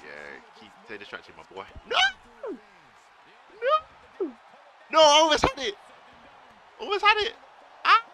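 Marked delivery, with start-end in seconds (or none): 1.9-8.0 loud, high anguished yells; real frustrated shout. Real, not acted. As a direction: scream it like you just missed the win. Nearly won the match.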